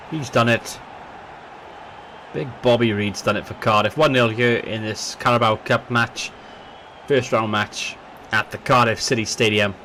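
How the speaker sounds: football commentator calling the match; live match commentary, building with the chances; play-by-play commentary. Acted.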